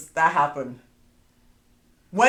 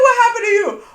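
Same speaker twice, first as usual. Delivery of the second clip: much higher, louder shocked question; 8 dB louder than usual. Retold or imitated. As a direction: sound alarmed at what happened. Shocked wife questioning fallen husband.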